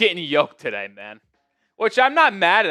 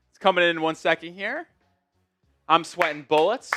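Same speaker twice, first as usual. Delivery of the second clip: ordinary delivery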